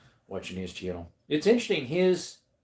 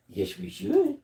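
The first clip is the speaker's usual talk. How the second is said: dog's reply voice; 13 semitones higher, 4 dB louder, slower than usual. Pretend. Answering as the dog.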